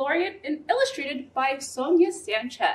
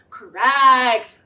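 ordinary delivery

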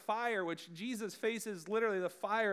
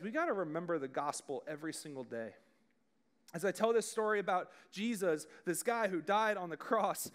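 ordinary talk, nothing marked

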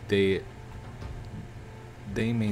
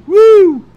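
loud, very high "woo"; high whoop; 20 dB louder than usual. Real, not acted. Gamer as Ninja hops in, loud high whoop. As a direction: whoop at the top of the voice.

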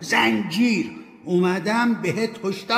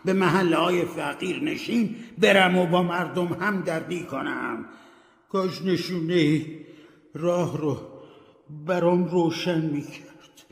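sad ghost; 9 semitones higher than usual. Acted. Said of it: "با ناراحتی گفت".

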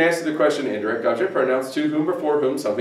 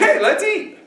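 very high, peppy invitation; eager suggestion. Acted. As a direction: call out an eager proposal. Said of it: "I'm saying".

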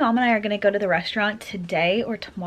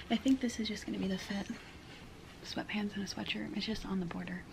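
whispering; 12 dB quieter than usual. Real, not acted.

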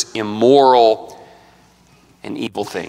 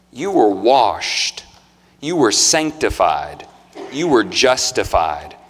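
slow emphatic; slower than usual. Acted. Preacher slowing for emphasis.

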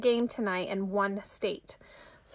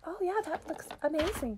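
high, gushing fake praise; hammed-up sugary compliment; fake enthusiasm; 7 semitones higher, 3 dB quieter than usual. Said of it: "you're supposed to be like".

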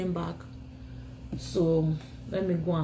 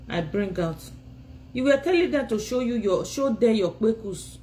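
ordinary talk, nothing marked

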